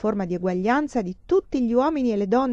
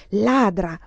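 louder, spiteful Ladra!; spiteful insult; 5 dB louder than usual. A narrator's reading. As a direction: spit Ladra! with resentment. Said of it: "Augusta ripeteva astiosa". (Ladra! detto astioso e più forte)

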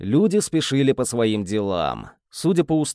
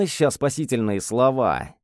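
usual speaking voice

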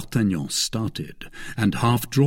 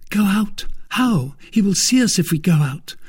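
high, louder, urgent questions; alarmed; 10 semitones higher, 4 dB louder than usual. Acted. Woman afraid of being seen going out, alarmed. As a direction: protest in a quick, high fluster.

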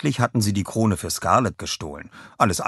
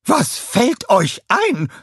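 high, loud, drawn-out outrage; furious scream; 6 dB louder, much slower than usual. A narrator's reading. Scarlett to the Minions after being hit, furious scream. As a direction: shout it slowly in red-hot indignation. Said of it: "schrie sie mit hochrotem Gesicht".

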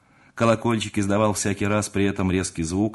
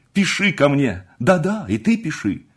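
high, loud, fervent urging; ardent, high-pitched farewell; eager parting plea; 8 semitones higher, 5 dB louder than usual. Acted. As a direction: press your friend excitedly. Ardent friends part, urging letters.

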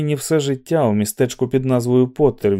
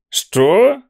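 loud, much higher delighted Що; gleeful exclamation; 10 semitones higher, 5 dB louder than usual. A narrator's reading. McVries cheerfully pretends not to hear Olson. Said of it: "радісно вигукнув".

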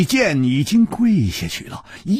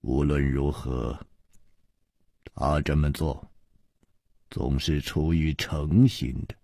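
gentle voice; 10 semitones lower than usual. A narrator's reading. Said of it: "柔声说".

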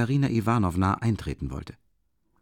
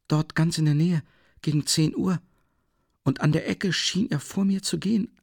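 put-on timid young woman; 8 semitones higher than usual. Sonja timidly tells Raskolnikov she saw her dead father. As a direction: voice Sonja as shy and unsettled.

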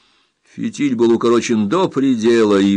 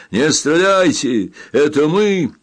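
raised, drawn-out warning call; 6 semitones higher, 4 dB louder, slower than usual. A narrator's reading. Captain stops rescuers firing, calling out. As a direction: hail them urgently from a distance.

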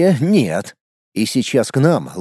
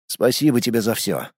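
soft, low farewell; tender thanks; 4 semitones lower, 4 dB quieter than usual. Acted. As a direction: say it softly while hugging. Hero hugs departing pet.

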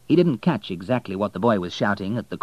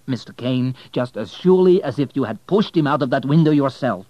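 loud, raised, hammering tirade; furious accusation; 7 semitones higher, 9 dB louder than usual. Acted. Accusing the banker of killing Leroy.

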